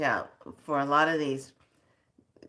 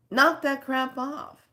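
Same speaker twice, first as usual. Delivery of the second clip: higher and slow, each word hit; exasperated final warning; angry scolding; 9 semitones higher than usual. Real, not acted. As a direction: scold them to stop, drawn out. Ranting at clickbait posters.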